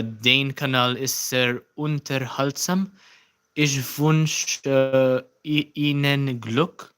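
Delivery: slow, halting German read aloud. Shown as a demonstration. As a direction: sound out the German words carefully. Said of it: "I'll try to read this in German".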